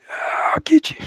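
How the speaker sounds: high, whispery little threat; whispered thumb threat. Retold or imitated. The thumb vowing revenge, high whispery threat. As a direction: hiss a petty promise of revenge. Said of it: "It was like".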